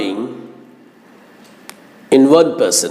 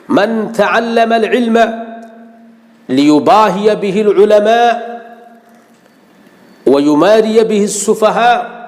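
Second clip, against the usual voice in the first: rapid Arabic recital, higher and ringing; hadith recitation; 7 semitones higher, much faster than usual. Acted. Preacher warning the huffaz with a hadith, in a rapid, raised recital. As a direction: chant out the hadith quickly and with force.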